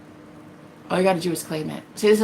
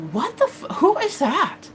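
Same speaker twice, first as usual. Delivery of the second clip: shocked; 7 semitones higher than usual. Retold or imitated. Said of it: "And I was like".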